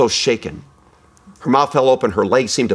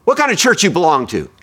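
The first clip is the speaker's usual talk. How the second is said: trace of hostility; 6 semitones higher than usual. Retold or imitated. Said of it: "a trace of hostility in his voice".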